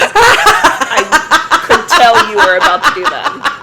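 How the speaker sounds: loud, high, laughing through the words. Real, not acted. Co-host teasing host over a skipped step, laughing hard. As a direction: crack up while you say it. Co-host cracks up at near slip.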